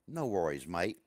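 low-pitched fake Aussie; bad Australian accent. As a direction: put on a poor Australian accent, dropped low. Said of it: "I now speak Australian".

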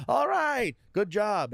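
high, loud host bluster: all right; hammed-up host wrapping up a bombed set; mock host sign-off, high and loud. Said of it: "Conan had to come out and be like".

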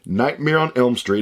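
usual speaking voice

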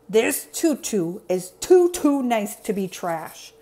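slow, grouchy character voice; Oscar scowling. Acted.

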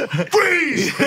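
high, shouted freeze; mock stickup yell. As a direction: bark it like a robber. Inmate practicing holdup command.

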